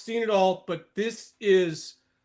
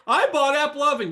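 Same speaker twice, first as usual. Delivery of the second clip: higher, louder mocking quote; mock boast; 6 semitones higher, 5 dB louder than usual. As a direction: imitate a bragging buyer. Mimics bullish buyers boasting.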